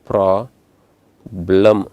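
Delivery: ಪ್ರಾಬ್ಲಮ್ slowly in two beats, pra, blam; split into syllables. Shown as a demonstration. Teacher splitting ಪ್ರಾಬ್ಲಮ್ for the class, two slow syllables. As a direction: pause inside ಪ್ರಾಬ್ಲಮ್ between pra and blam. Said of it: "split ಮಾಡಿ".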